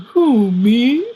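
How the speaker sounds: innocent "who, me?". Retold or imitated. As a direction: play caught-out innocence. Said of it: "that ridiculous hangdog".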